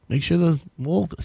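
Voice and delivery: usual speaking voice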